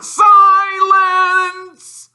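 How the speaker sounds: huge high bellow; yelled. A narrator's reading. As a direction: roar it to shut him up. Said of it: "yelled Uncle Vernon".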